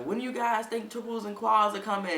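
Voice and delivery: nagging retold question; pestering. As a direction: imitate yourself bugging people with an eager question. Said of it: "I had been bugging these people".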